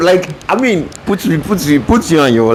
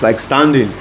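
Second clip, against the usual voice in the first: ordinary talk, nothing marked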